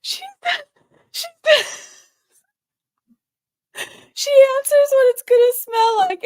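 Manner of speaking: high and loud, talking through laughter; forcing the words out between laughs; laughing. Real, not acted.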